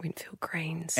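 whisper. Real, not acted. Cohost echoes in a whisper.

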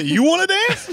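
squeaky, high flirty "you wanna dance"; high stripper voice. Retold or imitated. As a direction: pitch way up, coy and teasing. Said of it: "You say".

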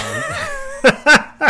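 loud, high-pitched real laugh; burst of laughter. Real, not acted. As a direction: crack up loudly. Cracks up at Bolton remark.

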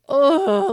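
straining groan. Retold or imitated. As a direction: push the groan out like the last rep. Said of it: "the sounds that come out of my mouth".

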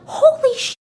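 high-pitched mock shock; retold shock. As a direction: act out a stunned, squeaky exclamation. Retold shock at creepy photo.

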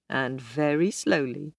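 usual speaking voice

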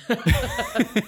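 high, loud burst of laughter. Real, not acted. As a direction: let out a big laugh. Host laughs after thanking.

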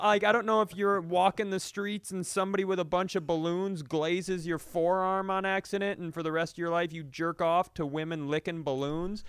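usual speaking voice

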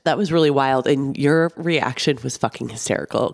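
usual speaking voice

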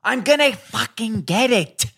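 exasperated outburst. Retold or imitated. Husband snaps at wife over laundry, high exasperated outburst. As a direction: protest like you're the one being wronged. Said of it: "I'm like".

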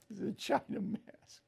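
chuckling through the words; laughing. Real, not acted.

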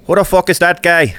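high, loud brash fighter voice; swaggering loud taunts. Pretend. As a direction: bark it like a cocky brawler. McGregor socks joke, acted voice.

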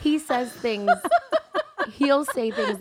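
amused, laughing through the words; laughing while talking. Real, not acted. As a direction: try to tell it through real laughter. Cracking up over Caleb's remarks.

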